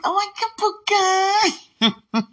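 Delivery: very high, very slow drawn-out squeal; excited shriek. Retold or imitated. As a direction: stretch the oh my God out in delight. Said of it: "But I was like".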